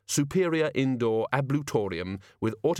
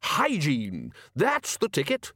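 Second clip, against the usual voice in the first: excited exclamation; 5 semitones higher, slower than usual. A narrator's reading. Ridcully cheerfully exclaiming.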